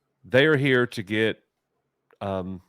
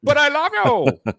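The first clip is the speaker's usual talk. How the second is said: high mock love plea; 6 dB louder than usual. Mimicking a character's love plea.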